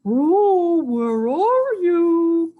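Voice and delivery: loud, high dog calling a friend; high singsong call, full volume. A narrator's reading. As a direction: holler for someone, stretching the words. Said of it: "called Brody".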